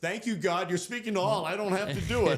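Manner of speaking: high, loud, overjoyed relief; relieved thanks. Retold or imitated. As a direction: gush your thanks, off the hook.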